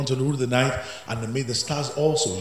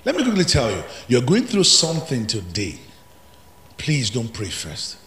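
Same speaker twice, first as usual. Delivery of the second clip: ordinary delivery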